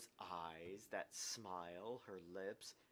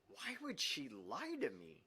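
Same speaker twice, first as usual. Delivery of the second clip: anguished question; 12 semitones higher, slower than usual. Acted.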